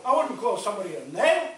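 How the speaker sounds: normal talking voice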